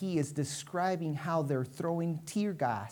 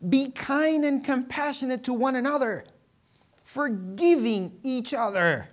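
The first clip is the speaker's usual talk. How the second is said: loud, high-pitched proclamation, slowing toward the end; forceful Scripture preaching; 8 semitones higher, 9 dB louder than usual. Real, not acted. Preacher reading Paul's words to the congregation, loud and emphatic. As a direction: proclaim each phrase to the whole church.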